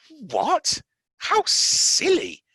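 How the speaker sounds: high, loud, slow scornful cry; girl outraged at the spoilt boy; indignant outburst. Acted. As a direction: spit out how silly. Mary angry outburst.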